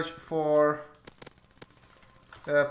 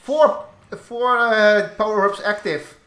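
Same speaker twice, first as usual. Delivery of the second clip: excited; 6 semitones higher, 8 dB louder, slower than usual. Real, not acted. Excited callout in game.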